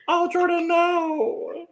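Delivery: high-pitched mock wail; mock dismay. Viewer retelling their reaction to Jordan's shirt, high mock dismay. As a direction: cry it out high in playful dismay.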